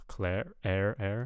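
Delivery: "cler-" said as "clair"; mispronounced vowel. Shown as a demonstration. Reviewer copying the learner's cler-, with an air vowel. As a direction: turn "cler-" into an air sound. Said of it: "but I'm hearing, like".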